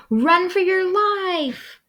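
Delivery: very high squeaky panic; squeaky critter yelling warning; panicked. Acted. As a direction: cry out in terror, a little slow. Prairie dog panics.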